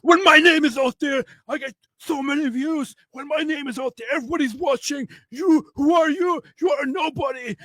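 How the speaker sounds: very high, strained mock rant; Myron. Retelling the rival's boastful outburst, in a high mock shout. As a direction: rant in a squeezed high yell. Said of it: "he starts shouting at me like".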